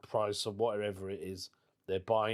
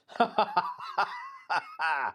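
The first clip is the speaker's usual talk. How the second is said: hearty burst of laughter; 4 semitones higher than usual. Real, not acted. Laughs at own remark.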